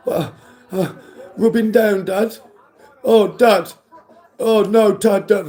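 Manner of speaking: hammed-up horrified whine to Dad; mock whining sons. Sons told to rub down the woodwork, mock whining. Imitates sons dreading the sanding.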